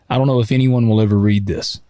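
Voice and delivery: normal talking voice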